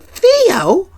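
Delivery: high, shocked cry of his name; stunned her toy is crushed; shocked little sister. Retold or imitated. Rudy shocked.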